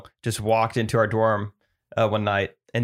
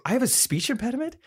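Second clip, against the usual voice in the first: disbelief; 14 semitones higher than usual. Retold or imitated. Friend's shocked question retold.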